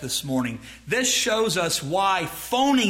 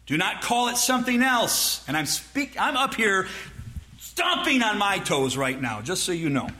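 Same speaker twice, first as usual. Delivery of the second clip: ordinary talk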